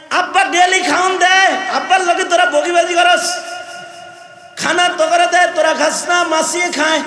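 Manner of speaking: preacher acting an indignant boy; scolding the idols. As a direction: tell the idols off with contempt. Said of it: "খুদা করে দিয়া কয়".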